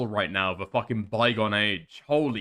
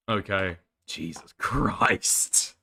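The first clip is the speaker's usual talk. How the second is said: slow, breathless whisper; whispered groan at the video; exasperated whisper; 6 semitones lower, much slower than usual. Real, not acted. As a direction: whisper it in disbelief, drawn out.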